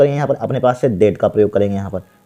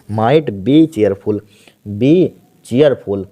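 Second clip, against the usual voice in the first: slow dictation; much slower than usual. Shown as a demonstration. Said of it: "might थोड़ा सा आप सीख लीजिए".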